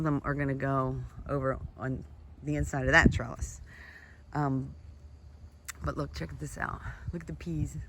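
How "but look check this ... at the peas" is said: ordinary talk, nothing marked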